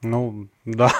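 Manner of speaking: ordinary talk, nothing marked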